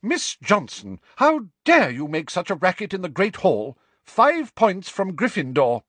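high, loud professor's reprimand; stern scolding. A narrator's reading. Professor scolds pupil for racket in the hall, stern scolding. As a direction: scold indignantly, letting the voice ring out.

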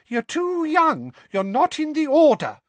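high-pitched mock mum; mocking mother impression. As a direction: mimic his mother's high nagging. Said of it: "high-pitched voice like his mother's".

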